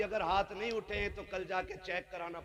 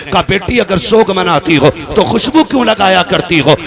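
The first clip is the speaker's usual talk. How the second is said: very loud retold question; impassioned preaching; 3 semitones higher, 26 dB louder than usual. Preacher voices the father-in-law questioning Amina, impassioned preaching. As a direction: press the question at full force.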